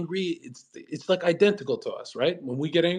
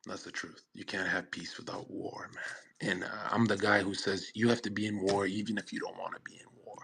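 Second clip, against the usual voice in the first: the same line repeated in a soft whisper; 5 semitones lower, 10 dB quieter than usual. Shown as a demonstration. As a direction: lean in and whisper 'You can't have peace without war'. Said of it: "and you can't have peace without war".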